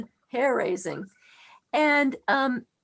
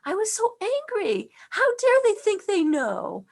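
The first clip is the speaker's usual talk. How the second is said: higher, louder, indignant cry; how dare they, raised and outraged; indignant outburst; 8 semitones higher, 3 dB louder than usual. Retold or imitated. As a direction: let the old outrage break through, high and loud. Boy voicing anger at explanations.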